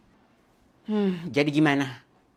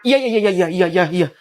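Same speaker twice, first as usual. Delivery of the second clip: grudging; 6 dB louder than usual. Acted. Child grudgingly agrees.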